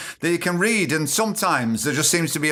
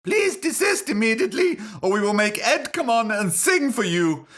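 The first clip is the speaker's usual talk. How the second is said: high-pitched mock solicitor voice; solicitor's letter; 5 semitones higher than usual. Solicitors warn the show to desist, high mock-lawyer voice. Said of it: "Sue Grabbit and Bastard Solicitors".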